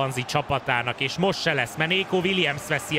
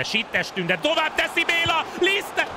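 very high commentator shout; excited Hungarian sports commentary. Acted. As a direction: yell the chance high. Chance called out.

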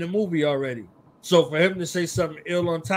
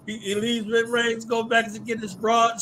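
excited retelling; 6 semitones higher, 3 dB louder than usual. Real, not acted. Excited retelling of movie scene.

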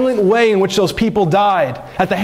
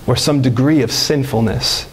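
usual speaking voice

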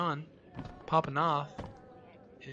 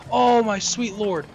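drawn-out exclamation; 6 semitones higher, 10 dB louder than usual. Real, not acted. Relief after escape.

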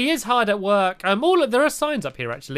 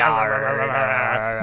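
pirate voice; 10 semitones lower, slower than usual. Acted. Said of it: "It says".

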